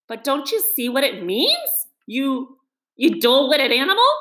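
impatient. A narrator's reading. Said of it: "cried the rat impatiently".

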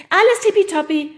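put-on very high, loud girl's voice; calling out. Oda cuts in on the teacher, high and loud. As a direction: chirp it in brightly. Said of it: "rief Oda dazwischen".

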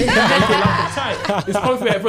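loud laughing storytelling. Real, not acted. Laughing to friends about a strict dad.

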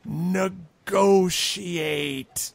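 high goblin, NEGOTIATE stretched out syllable by syllable; pompous high voice savoring a newly learned word; painstakingly rehearsed word. Acted. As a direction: pronounce negotiate very slowly and proudly. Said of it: "he's been working on this".

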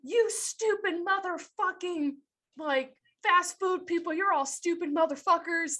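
mock the enraged customer screeching insults; imitating furious yell. Imitating angry customer yelling insults at fast food worker, high mock screech. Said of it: "she just kept yelling at me".

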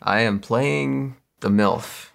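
ordinary talk, nothing marked

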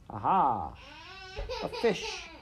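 high, gleeful bird voice; gleeful kingfisher. A narrator's reading. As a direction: pounce on it with glee. Kingfisher spotting prey.

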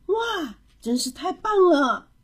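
high wow, then admiring praise; impressed praise. A narrator's reading. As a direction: praise a child's picture with delight. Father praises the picture. (惊喜地夸奖)

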